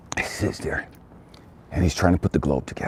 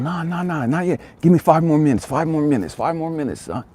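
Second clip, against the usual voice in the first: father yelling; 9 semitones higher than usual. Acted. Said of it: "The father yells to him".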